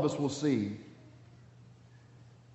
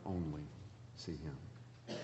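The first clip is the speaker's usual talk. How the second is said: hushed; 11 semitones lower, 19 dB quieter, slower than usual. Acted. Sermon climax dropped to hush.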